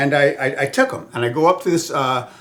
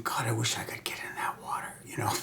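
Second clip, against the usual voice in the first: soft unvoiced whisper; whispered wish; 3 semitones lower, 13 dB quieter than usual. Wishing to get in the water, whispered. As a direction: murmur the wish under your breath. Inner wish voiced as thought.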